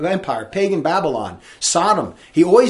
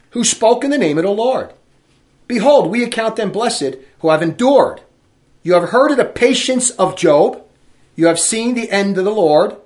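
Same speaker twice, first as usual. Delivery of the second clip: scripture reading; 2 semitones higher, 4 dB louder than usual. Acted. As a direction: proclaim the verse to the congregation. Preacher reads Epistle of James.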